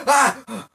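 high, loud waking scream; startled scream. Retold or imitated. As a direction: jolt awake yelling. Waking with stomach ripped feeling.

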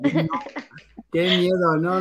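ordinary talk, nothing marked

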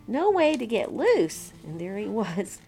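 ordinary delivery

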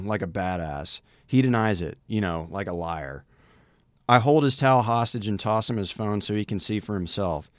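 ordinary talk, nothing marked

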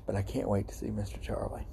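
tender whisper. Real, not acted. Longing to see his dead cat, whispered. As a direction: whisper it like a private wish.

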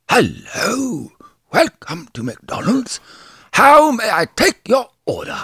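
put-on high, slow theatrical Old English greeting; Old English accent. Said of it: "It's, like, Old English".

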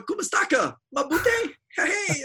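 high, loud, cheery calling out; child's excited greeting. Retold or imitated. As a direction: greet the crew like a delighted toddler. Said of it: "looking at the people going".

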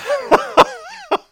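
sudden high burst of laughter; real laughter bursting out; loud high laugh. Real, not acted.

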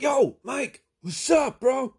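very high, loud mock-excited shout; enthused. Clerk greeting his regular customer, wildly enthused. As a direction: holler it like an over-eager bro. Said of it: "Henry is still enthused".

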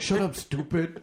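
sneering mock put-down, high and loud; mock bully telling the dreamer to shut up; dismissive bully. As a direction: snap it like a scornful bully. Voicing dismissive world.

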